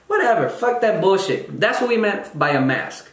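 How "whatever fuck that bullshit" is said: ordinary delivery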